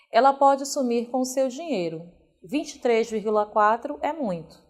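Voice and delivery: normal talking voice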